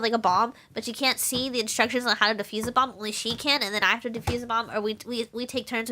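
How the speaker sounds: ordinary talk, nothing marked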